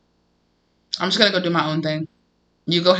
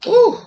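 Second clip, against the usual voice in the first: high excited ooh; 6 dB louder than usual. Real, not acted. Excited reaction to card.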